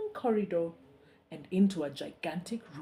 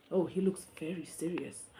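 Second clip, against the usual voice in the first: usual speaking voice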